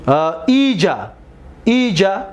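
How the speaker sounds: ordinary talk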